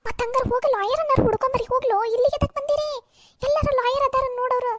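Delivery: loud, agitated orders; frantic scolding. Acted. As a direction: scold them into going for help. Distraught mother orders them out. (ಗಾಬರಿಯಿಂದ ಜೋರಾಗಿ ಗದರುವುದು)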